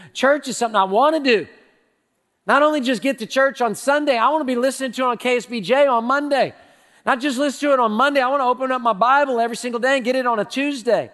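rally the congregation with rising fervor; full-force preaching. Real, not acted.

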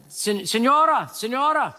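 high, slow, gentle offer of help; nice voice. Retold or imitated. Pastor offering help to a passing lady, high and gentle. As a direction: coax her kindly in halting Spanish. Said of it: "I said it with a nice voice".

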